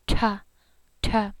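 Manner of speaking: ta with a strong puff of air; aspirated ta. Shown as a demonstration. Teacher demonstrating the breathy ta. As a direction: blow air out with the ta. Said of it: "just blow air with it".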